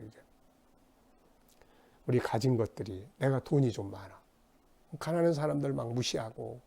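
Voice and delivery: ordinary delivery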